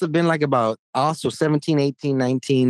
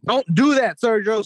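higher-pitched mock scolding; talking down as to a pet; 5 semitones higher than usual. People correcting the team's helper like a pet, condescending mock scolding. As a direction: scold like you would a pet, imitated. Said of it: "talk down to him like a damn pet".

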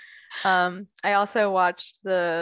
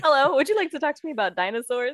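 put-on giddy party girl; 7 semitones higher, faster than usual. Quoting a favorite movie character.

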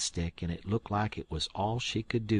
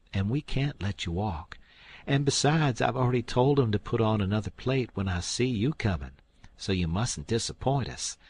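higher, louder bustling aunt; Aunt Sally insisting; 3 semitones higher, 5 dB louder than usual. Acted. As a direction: pile on the hospitality. Aunt Sally insists guest stays.

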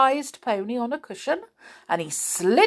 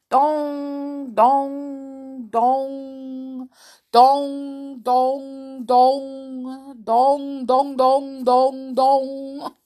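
chiming clock; 5 semitones higher, 3 dB louder, much slower than usual. Acted. Clock chimes twelve.

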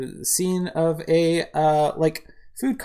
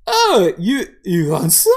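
put-on loud, halting drunken offer; drunk man; 5 semitones higher, 10 dB louder, slower than usual. Drunk man offering the cat some food, loud and tipsy. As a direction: start high, stumble tipsily into the question. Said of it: "the drunkman looks at him and goes".

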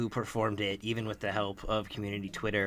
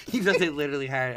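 laughing; 8 semitones higher, faster than usual. Real, not acted. Laughing disbelief at the mistake.

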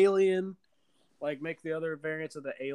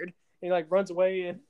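ordinary talk

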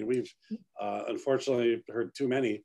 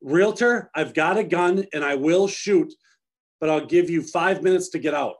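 loud, high announcement to intruders; imitating an agent's loud warning; shouted warning; 5 semitones higher, 7 dB louder than usual. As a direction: call out a firm threat through the house. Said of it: "he would then come in and he goes".

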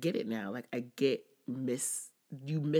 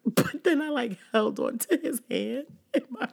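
laughing; 11 semitones higher, 7 dB louder than usual. Real, not acted. Laughing while retelling.

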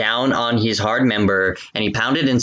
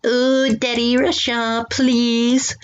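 much higher whiny pleading moan; mock moaning plea; 12 semitones higher, much slower than usual. Reader begs Daddy Russia, high mock moan. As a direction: beg in a hammed-up falsetto.

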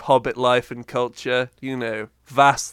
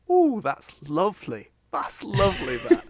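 higher, gushing "Ooh, that's lovely"; cooing British judge; 6 semitones higher than usual. Retold or imitated. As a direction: coo over the bake like a kind judge. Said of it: "people coming around going".

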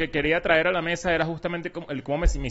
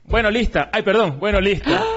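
higher, louder mock manicurist; chirpy service voice, higher and louder; quoting the manicurist; 6 semitones higher, 4 dB louder than usual. Manicurist telling her customer she's done, higher and louder. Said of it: "la caraja me dice".

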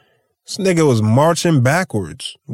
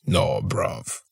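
British slang, whispered; 11 semitones lower, 8 dB quieter than usual. Retold or imitated. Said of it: "that's that English shit".

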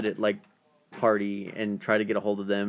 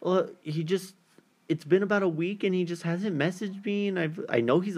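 raised pitch, retelling a caller's account; girl caller; 9 semitones higher than usual. Retold or imitated. Woman telling radio hosts about her silent date, in higher caller voice. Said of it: "this girl, she's like".

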